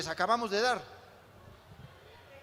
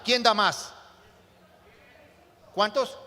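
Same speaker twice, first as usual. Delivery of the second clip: loud auctioneer asking for bids; auctioneer call; 2 semitones higher, 5 dB louder than usual. Pretend. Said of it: "Voy a hacer una especie de subasta".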